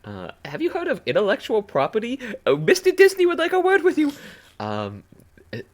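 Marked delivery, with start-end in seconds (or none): 0.4-4.1 high, loud snooty lawyer voice; mock lawyer voice. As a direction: lecture like a pompous rights lawyer. Mock copyright lawyer lecture.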